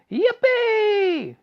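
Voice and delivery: high, loud whoop of delight; joyful shout. Acted. Grandpa Joe hearing he may go, loud high whoop. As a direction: whoop like an old man set free. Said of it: "shouted Grandpa Joe".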